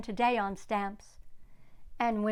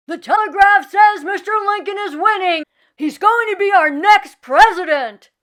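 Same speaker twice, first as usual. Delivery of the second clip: much higher, very loud street cheering; jubilant shout; 9 semitones higher, 16 dB louder than usual. Acted. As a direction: yell the news to the whole street.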